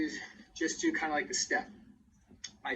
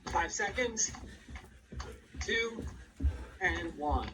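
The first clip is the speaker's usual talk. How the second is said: energetic, higher fitness cueing; aerobics instructor; 3 semitones higher, 3 dB quieter than usual. Acted. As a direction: push the class to keep going. Cueing class during high knees.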